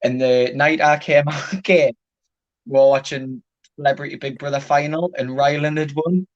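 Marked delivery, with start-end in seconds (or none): none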